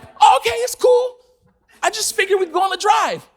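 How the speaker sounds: high-pitched, loud cover-up; acted fake nonchalance. Acts his nervous cover-up reply.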